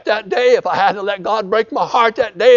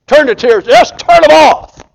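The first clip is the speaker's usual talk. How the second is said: building shout; 7 semitones higher, 8 dB louder than usual. Acted. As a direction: repeat "Turn the tears, just turn them off" much louder and higher, slowing on "turn them off". Preacher repeats command louder.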